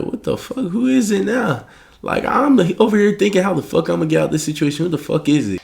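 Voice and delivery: high-pitched, exasperated muttering to himself. Retold or imitated. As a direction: retell it as rattled, annoyed disbelief. Startled by doorbell while worrying.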